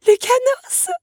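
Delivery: high, loud joyful cry of a name; exclaiming. A narrator's reading. Belle sees the beetle arrive, exclaiming loudly. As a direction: call his name out in relief. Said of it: "s'exclama cette dernière".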